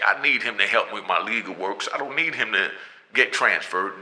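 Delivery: ordinary talk, nothing marked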